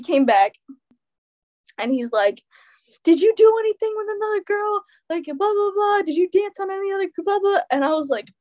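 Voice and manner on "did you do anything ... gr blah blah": high-pitched mocking rant; hammed-up nagging, stuck high; mock yelling. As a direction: imitate an upset ex grilling you. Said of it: "he's, like, screaming and yelling at me".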